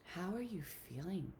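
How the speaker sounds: soft, soothing, with long pauses; hushed, caring questions, leaving space to feel; tender self-talk. Shown as a demonstration. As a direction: gently check in with yourself. Models gentle talk to oneself.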